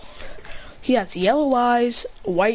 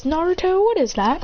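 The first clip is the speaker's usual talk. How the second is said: high-pitched curious question; lightly acted wonder; 8 semitones higher than usual. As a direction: ask in a lifted kid voice. Children ask about creature.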